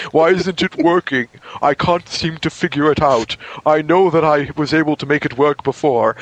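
lightly put-on English accent, puzzled; mock English accent. Friend mimics cameraman fumbling the camera in mock English accent. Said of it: "Does he have an English accent?".

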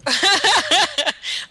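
loud, high burst of giggling; embarrassed laugh. Real, not acted. Laughs at own embarrassing story.

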